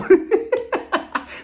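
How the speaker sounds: high airy laugh; breathy laugh; real laugh. Real, not acted. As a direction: burst out laughing. Laughing at cut scenes.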